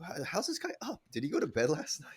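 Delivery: high, incredulous wondering; imitating his own amazed reaction; amazed disbelief. Host marvelling at his friend's early message, mock amazed. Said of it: "I'm like".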